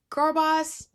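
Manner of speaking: mock cheerleader. Parodying the story's message.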